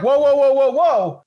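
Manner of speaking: high, loud whoas; hammed-up backpedal; mock alarm. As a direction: back off fast, hands up in the voice. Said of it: "Might be like".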